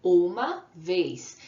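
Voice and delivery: drawn out slowly, one beat; slow counted answer. Shown as a demonstration. Teacher slowly counting syllables for kids. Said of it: "Quantas vezes você abriu a boca".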